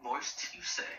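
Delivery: unvoiced, teasing whisper; suggestive whisper. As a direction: breathe it out with a naughty wink. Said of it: "someone would have said moist in a different way".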